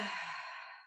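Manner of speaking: long open-mouthed exhale; voiceless sigh out through the mouth; big cleansing sigh. Shown as a demonstration. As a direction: let the breath go in a big, airy sigh. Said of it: "with a nice big sigh".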